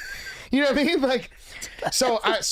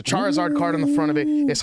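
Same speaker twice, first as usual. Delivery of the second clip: much higher, louder and fast, thrilled; excited gush; 12 semitones higher, 4 dB louder than usual. Real, not acted. Gushing about the Charizard card.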